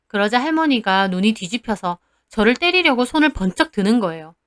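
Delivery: ordinary delivery, nothing marked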